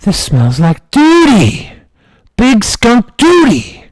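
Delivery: put-on high, slow toddler exclaiming; little kid voice. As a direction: squeak it out like a three-year-old. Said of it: "and I said".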